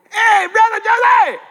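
long, high, loud hail across a crowd; calling out. Retold or imitated. Fans spotting the preacher at the Derby, high loud call. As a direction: shout a happy greeting from far off.

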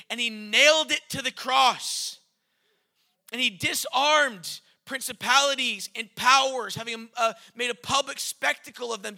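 loud, fervent preaching. Acted. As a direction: preach it out with full force. Preaching at full force.

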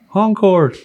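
a cop barking out the prison name; calling out Concord. Retold or imitated.

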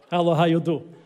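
put-on playful Russian-accented greeting; mock Russian accent. Preacher shows congregation the greeting, mock Russian accent. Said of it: "I come from Mother Russia".

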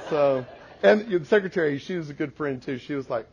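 normal talking voice

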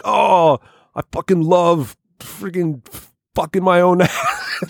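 high, slow goofy voice breaking into a laugh; mocking Sam. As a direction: drag it out like a dopey guy, cracking up. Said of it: "Sam was like".

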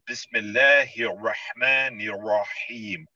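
slow, careful Arabic with "Bismillahir" flowing into "Rahmanir Rahim"; correct Arabic recitation. Shown as a demonstration. Asked by the speaker to say it right, slow careful Arabic recitation. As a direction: recite it properly, each syllable given its due weight. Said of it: "Can you say it?".

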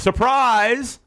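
high, cheering shout; yelled surprise. Acted. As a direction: shout it like an office crowd. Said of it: "was yelled".

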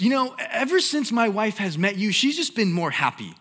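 mock husband pleading high and loud; preacher retelling a husband's phone call, high and loud; frantic husband. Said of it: "he calls and he goes".

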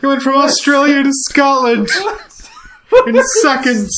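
a friend stops the fake accent, laughing and loud; excited protest. Real, not acted. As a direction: cut in, amused and loud.

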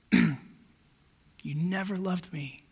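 soft, very slow, broken repeat; quiet tearful echo. Retold or imitated. Preacher softly echoes the weeping man's words, hushed and slow. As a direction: let the accusation fall away in a hush. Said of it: "he's got tears in his eyes".

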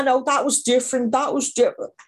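lightly voiced excuse-maker brushing it off. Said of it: "and you go".

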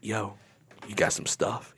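low, very quiet undertone; mock-cool, covert street ask; trying to be cool. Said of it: "I try to be cool".

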